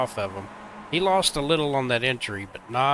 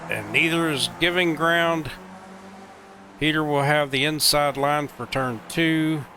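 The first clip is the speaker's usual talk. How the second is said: excited play-by-play, pitch raised; motorsport commentator; 2 semitones higher than usual. Real, not acted. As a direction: call the battle live, building energy. Live race play-by-play.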